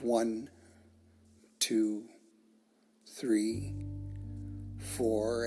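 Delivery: even count, a full pause after each number; measured counting pace. Shown as a demonstration. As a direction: pace the listener's in-breath number by number. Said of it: "at the pace".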